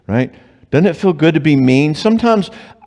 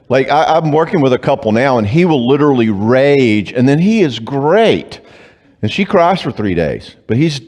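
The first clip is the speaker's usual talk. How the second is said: normal talking voice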